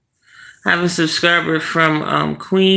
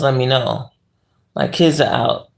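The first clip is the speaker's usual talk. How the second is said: normal talking voice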